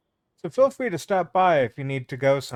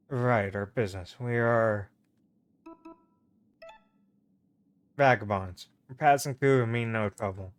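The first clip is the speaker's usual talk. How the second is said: slightly lowered, guarded traveler voice; a hint of a wary adventurer; lightly voiced vagabond; 3 semitones lower than usual. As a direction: answer the gate guard in a low, measured voice.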